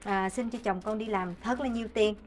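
soft, low prayer voice; praying. Acted. Wife praying to the Kitchen God for her household, softly praying. Resumes prayer after interruption.

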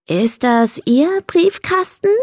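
put-on girl asking the odd hermit ever so nicely; nicest voice. A narrator's reading. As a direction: ask as politely as you possibly can. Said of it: "mit ihrer nettesten Stimme".

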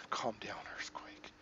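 hushed joking whisper. Acted. Player mocks the dialogue choice, whispered. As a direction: breathe it under your breath.

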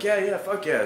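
high, eager fuck yeah; excited reply to a hot dog offer; eager yes. Retold or imitated. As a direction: jump at the offer. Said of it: "I'm like".

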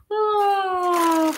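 bursting into a laugh. Real, not acted. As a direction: laugh it off, amused. Laughs at forgetting glasses.

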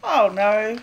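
ordinary delivery

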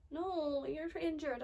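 usual speaking voice

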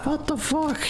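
high shocked outcry. Real, not acted. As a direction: pitch jumps up in shock. Surprised by game.

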